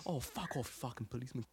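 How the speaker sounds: quiet mock Australian grumble; soft cartoon Australian moan, dropping away; Australian accent imitation. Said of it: "an imitation of this one YouTube".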